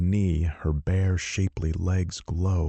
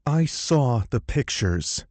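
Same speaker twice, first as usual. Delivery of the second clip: growling heroine; 8 semitones higher than usual. Acted. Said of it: "she growls".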